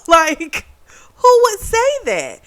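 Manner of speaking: high, loud incredulous outburst; outraged disbelief. Real, not acted. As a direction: voice shooting up in indignation. Outraged rant at the insult.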